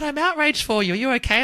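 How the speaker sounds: overdone shocked sympathy; high, loud mock outrage. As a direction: gush in fake alarm at the bad review. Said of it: "people are like".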